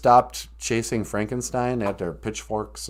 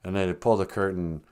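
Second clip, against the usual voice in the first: ordinary talk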